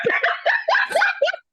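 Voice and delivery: high, bursting laugh; real uncontrolled cackle up high; helpless laughter. Real, not acted. Friend going to hell for laughing, helpless high laughter. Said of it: "I'm just gonna laugh".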